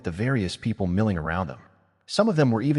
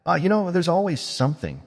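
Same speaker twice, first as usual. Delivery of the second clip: breezy brush-off, pitched up; dismissive; 8 semitones higher than usual. A narrator's reading. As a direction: wave the question away. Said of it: "she said dismissively".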